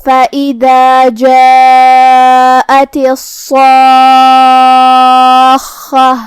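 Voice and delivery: slow, drawn-out tajweed chant; measured melodic Quranic recitation. Shown as a demonstration. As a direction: recite it slowly with full elongation.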